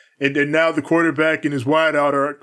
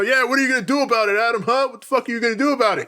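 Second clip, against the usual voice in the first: taunting Kyle; 7 semitones higher, much faster than usual. Retold or imitated.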